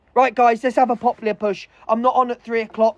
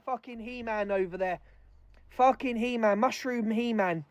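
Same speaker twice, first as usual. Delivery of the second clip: muttered mockery; 2 semitones lower, 9 dB quieter than usual. Real, not acted. Mocking muttered jab.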